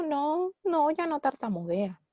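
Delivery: dismissive denial. Retold or imitated. Parent assuring the therapist the child is fine, imitated denial.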